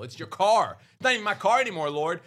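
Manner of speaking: high, loud mock plea to the Lord; emphatic surrender. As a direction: hand it all over at full pitch. Acted surrender prayer to the Lord.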